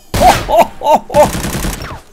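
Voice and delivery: breathy laugh after a fright; nervous laugh. Real, not acted. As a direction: laugh off the scare.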